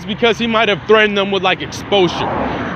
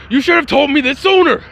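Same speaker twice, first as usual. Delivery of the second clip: indignant shout, higher pitched; Bakugo; 7 semitones higher than usual. Acted. Hot-headed boy scolding his training partner, indignant shout. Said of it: "some Bakugo-esque, like, type shit".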